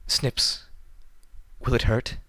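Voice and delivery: a hint of a scared little filly; small, childlike frightened question. A narrator's reading. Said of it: "Her voice was almost like that of a filly".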